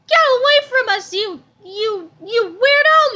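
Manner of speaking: high, loud horrified yell; mother yells at a weirdo, high and loud. A narrator's reading. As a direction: shriek him away. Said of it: "Missus Sneedley yelled".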